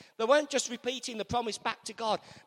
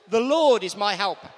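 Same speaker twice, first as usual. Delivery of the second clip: loud confident declaration; 2 semitones lower, 10 dB louder than usual. Shown as a demonstration. Preacher urging the congregation to speak up, loud confident declaration. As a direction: ring out The Lord is my helper, loud and sure. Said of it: "say it with confidence".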